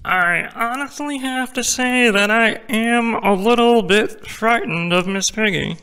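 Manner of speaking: high-pitched puppet frog voice; high frog-puppet impression, confessing awkwardly; Kermit the Frog. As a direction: speak as an earnest, nervous puppet frog. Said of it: "How about Kermit the Frog?".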